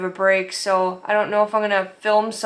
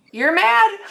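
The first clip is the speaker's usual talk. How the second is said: squeaky high, louder disbelieving "you're mad?"; incredulous mock question; 11 semitones higher, 4 dB louder than usual. Vendor mocking the offended customer, high incredulous squeak.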